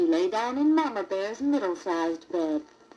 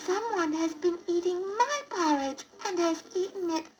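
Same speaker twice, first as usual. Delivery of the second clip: very high, tiny cub voice; Baby Bear's wee little voice; 11 semitones higher than usual. A narrator's reading. Baby Bear finds his porridge gone, tiny high cry. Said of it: "He cried out in his wee little voice".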